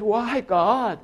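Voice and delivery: high, loud anguished cry to God. Retold or imitated. Pastor crying out to God in grief. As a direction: let the why burst out.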